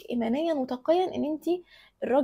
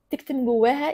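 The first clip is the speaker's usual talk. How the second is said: ordinary talk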